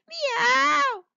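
a high, loud startled meow; a yowling cat, pitched high; cat's meow. Acted. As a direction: cry out like a cat about to get wet. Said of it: "he cried".

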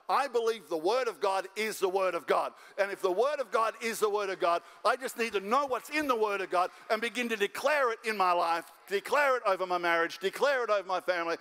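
ordinary delivery

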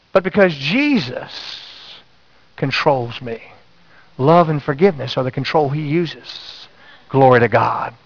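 slow, hushed testimony; slow right down and let it land; solemn preaching. Acted. Sermon climax.

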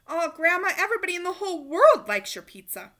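put-on boy praising grandma's pizza; enthusiastic. A narrator's reading.

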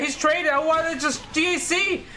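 nervous cry climbing into high-pitched panic; scared. Real, not acted. Gamer who can't get out of the opponent's attack, rising panic. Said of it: "I'm so scared, though".

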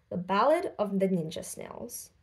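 plain reading voice

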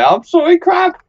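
high, sheepish apology to a crab; high apologetic voice. Pretend. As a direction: squeak out a silly sorry.

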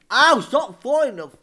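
loud, high, drawn-out complaint; exasperated yell. Real, not acted. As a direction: cry out slowly in exasperation. Yelling after another fall.

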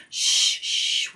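soft, voiceless hush; whispered shushing. A narrator's reading. Pippi hushing Annika beside the sleeping bull, whispering. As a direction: shush very softly. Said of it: "whispered Pippi".

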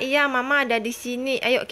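ordinary talk

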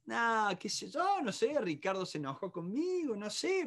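mock glum, quiet shrugging voice; acted sad son. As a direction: play a hurt kid reluctant to explain. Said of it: "Él sabe que yo estoy triste".

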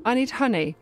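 ordinary talk, nothing marked

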